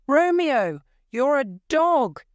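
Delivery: high, loud furious yell; angry shout across the churchyard. A narrator's reading. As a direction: hurl the insult at him full voice. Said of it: "he shouted".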